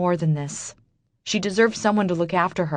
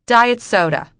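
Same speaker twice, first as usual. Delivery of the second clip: curt order; 6 semitones higher, 8 dB louder than usual. Acted. Customer ordering curtly.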